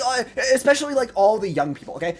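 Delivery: normal talking voice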